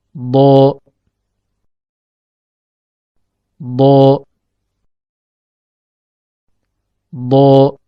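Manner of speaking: "bo" said as Arabic dho, tongue edge pressed on the molars; Arabic letter dhad. Shown as a demonstration. Teacher demonstrates the letter dhad for learners, "bo" as dho from the tongue's side. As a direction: say "bo" as a heavy, held dhad, not a b. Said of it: "Ketika mengucapkan huruf dhad".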